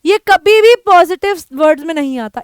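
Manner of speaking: ordinary talk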